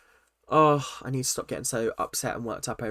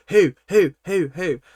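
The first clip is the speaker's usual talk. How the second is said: louder, mock-insistent who, who; mocking repetition; 2 semitones higher, 7 dB louder than usual. Host mocking Sam repeating who over Ash, louder. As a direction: parrot him to show how annoying it was. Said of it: "kept on repeating".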